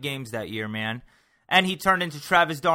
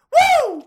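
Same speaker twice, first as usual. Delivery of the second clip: very high, loud whoop; a hyped-up cheer; excited woo; 10 dB louder than usual. Acted. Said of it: "trying to have more energy".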